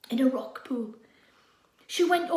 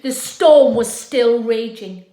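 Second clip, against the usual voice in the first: dramatic storm narration; 2 semitones lower, 14 dB louder, slower than usual. A narrator's reading. Describing the storm.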